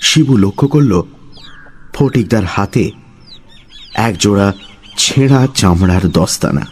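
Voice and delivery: ordinary delivery, nothing marked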